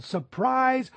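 a high, bright-pitched "Surprise!" called out; spring it on them, party style; yelled surprise. Pretend. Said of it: "He could have yelled".

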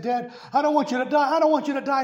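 put-on retelling a boy's panicked plea to his dad; worked-up pleading child. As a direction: beg like a scared child who won't let go. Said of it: "began to get all emotional and worked up".

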